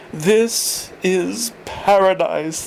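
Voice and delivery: slow, lightly voiced old man; declared. A narrator's reading. Old Thorpe admiring the grounds, slow and pleased. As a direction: savour each word like a contented old man. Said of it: "Thorpe declared".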